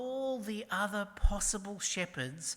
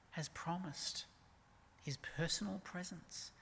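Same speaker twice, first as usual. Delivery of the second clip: ordinary delivery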